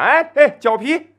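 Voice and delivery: calling out for a rickshaw. Retold or imitated. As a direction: hail the rickshaw across the street.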